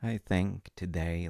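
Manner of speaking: ordinary delivery, nothing marked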